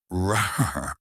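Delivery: long trilled rolled R. Shown as a demonstration. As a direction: roll the tongue on the R. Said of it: "roll an R".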